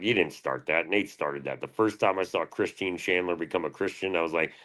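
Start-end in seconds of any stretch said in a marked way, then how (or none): none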